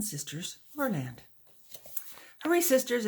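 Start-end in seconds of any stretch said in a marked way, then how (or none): 2.4-3.1 high, bright urging to hurry; eager encouraging call. A narrator's reading. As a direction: hurry them along with a lift. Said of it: "Eleanor encouraged".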